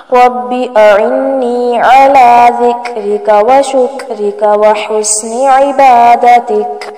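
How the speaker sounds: slow, measured Arabic recitation of a dua. Shown as a demonstration. Teacher reciting a dua slowly for students. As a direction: recite each word slowly for learners.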